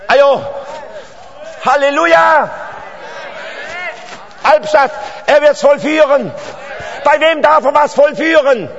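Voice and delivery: full-force preaching. Real, not acted. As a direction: shout the promise to the hall like a revival preacher. Preaching climax to Albstadt.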